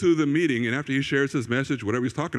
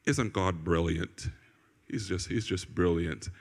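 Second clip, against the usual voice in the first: very laid-back, low, soft and slow impression; Graham Cook; 9 semitones lower, 8 dB quieter, slower than usual. Chilled speaker marvelling at God, laid-back imitation. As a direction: murmur it low, totally chilled. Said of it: "he's more laid back".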